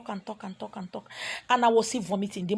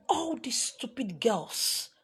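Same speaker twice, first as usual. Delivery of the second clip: mimicking the woman's insult; 6 dB quieter, much slower than usual. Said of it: "That was what she called me".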